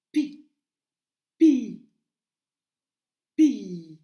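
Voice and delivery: "pi" loud and isolated, the i held longer each time; syllabe appuyée. Shown as a demonstration. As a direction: punch "pi" and draw out its vowel. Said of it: "pour faire le son". (« pi » fort et isolé, i de plus en plus long)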